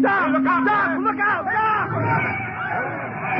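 frantic high warning yells, climbing each time; shouting a warning. Acted. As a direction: scream at him to get out of the road. Doc stepping into traffic.

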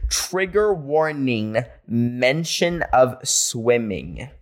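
"Trigger warning, mention of swimming" drawn out word by word; very slow careful reading. Shown as a demonstration. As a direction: spell it out slowly, testing each word. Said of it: "I don't even know if I'm saying it right".

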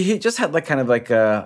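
ordinary delivery

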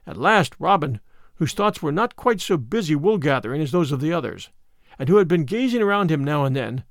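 usual speaking voice

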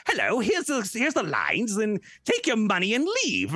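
high-pitched quoted character; hammed-up high mimicry; high mock voice. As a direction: put on a high voice, well above your own. Mimics pushy staff in retold exchange.

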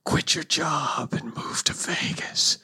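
eerie whispered command; ghostly whisper. Retold or imitated. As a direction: whisper like a phantom. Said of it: "the voice goes".

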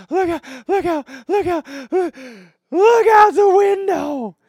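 very high, loud squeal through mock hysterics; imitating hysterical laughter. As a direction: shriek it like someone dying laughing. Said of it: "She's dying laughing".

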